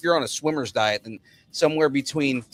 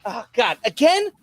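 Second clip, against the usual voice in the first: exasperated character; 8 semitones higher than usual. Pretend. Exasperated character quote, pitch jumps.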